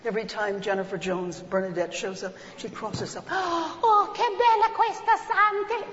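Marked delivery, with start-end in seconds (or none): 3.3-5.8 put-on high, loud, rapturous old lady in Italian. Old lady in the cinema marvels at the saint, high rapturous Italian. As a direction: swoon over the saint like an old Italian woman. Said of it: "Old lady sitting next to me".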